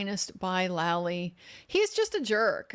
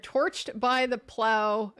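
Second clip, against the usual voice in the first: normal talking voice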